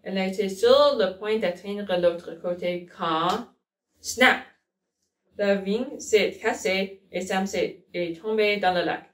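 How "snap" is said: sudden snap. A narrator's reading. Vine snaps in story.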